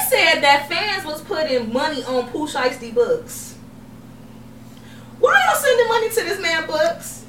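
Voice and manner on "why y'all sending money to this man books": incredulous. Real, not acted. Incredulous outburst.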